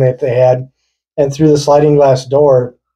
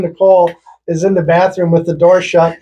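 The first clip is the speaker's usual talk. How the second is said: ordinary talk, nothing marked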